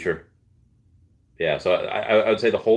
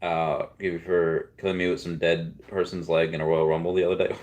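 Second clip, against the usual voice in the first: ordinary delivery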